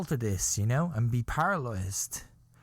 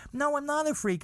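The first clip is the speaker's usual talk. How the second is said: high indignant protest; 11 semitones higher than usual. Retold or imitated. Retold denial in the argument.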